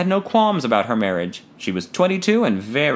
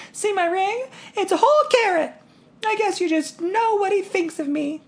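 put-on high girlish voice, teasing; teasing daughter. A narrator's reading. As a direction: show off the ring playfully. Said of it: "she teased".

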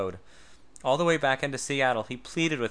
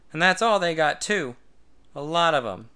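loud, high, opinionated retort; loud cocky opinion; 4 semitones higher, 6 dB louder than usual. A narrator's reading. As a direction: state it like a know-it-all. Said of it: "he opinioned".

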